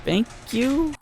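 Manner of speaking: high, embarrassed squeak of thanks; flustered squeak. Acted. Flustered customer escaping the shopkeeper, embarrassed squeak. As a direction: squeak it out and flee.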